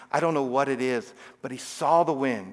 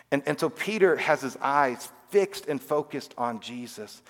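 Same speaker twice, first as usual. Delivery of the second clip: ordinary talk, nothing marked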